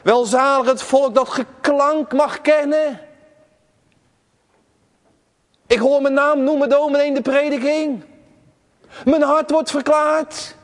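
full-force sermon delivery; preaching. Acted. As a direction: preach it out to the whole church.

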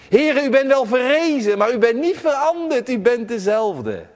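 full-force sermon delivery; preaching. Acted. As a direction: preach it out to the whole church.

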